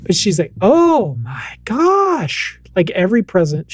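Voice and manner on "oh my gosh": high, slow, swooping wonder; thrilled little kid. Retold or imitated. Toddler daughter thrilled at every Christmas present, excited. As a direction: draw it out like an amazed toddler. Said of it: "she's very thrilled".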